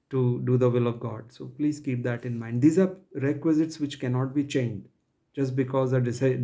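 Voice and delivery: usual speaking voice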